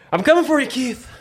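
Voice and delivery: high teasing mock threat; playful taunt. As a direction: call him out, playfully. Playful taunt at racing buddy.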